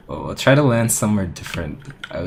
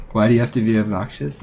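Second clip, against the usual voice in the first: mild mock yell, a bit raised; imitating a yell; 3 semitones higher, 4 dB louder than usual. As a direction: parrot the friend's exasperated shout. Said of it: "This is exactly how you yelled".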